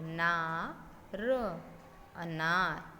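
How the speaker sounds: ना stretched long, then अनार with a long आ; long aa sound. Shown as a demonstration. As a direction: draw out the आ in ना and अनार. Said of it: "ध्वनि है लंबी जा रही है".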